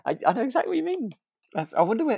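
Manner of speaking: ordinary talk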